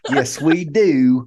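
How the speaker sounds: slow, playful Southern drawl. Pretend. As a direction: stretch it out with Southern twang. Playfully claiming Southern way of talking.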